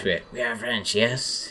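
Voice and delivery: hammed-up French accent with questioning yes; mock French accent. American mimics the French verbal habit, mock French accent. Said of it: "end every question with this".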